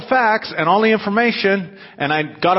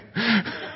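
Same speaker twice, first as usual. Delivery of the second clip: soft laugh; 4 semitones higher, 6 dB quieter than usual. Real, not acted. Laugh after parody.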